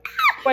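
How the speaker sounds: piercing high yelp of fright; loud high startled scream; high shriek. Retold or imitated. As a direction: shriek in alarm. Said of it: "So I'm like".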